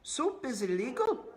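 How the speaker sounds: high, loud blurted protest; high protesting Meg voice; Meg's voice. Acted. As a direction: blurt it indignantly. Meg objects.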